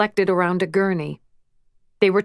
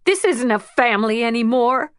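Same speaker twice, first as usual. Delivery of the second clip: put-on hurt teen retort; 4 semitones higher than usual. A narrator's reading. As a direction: throw it back, hurt and angry.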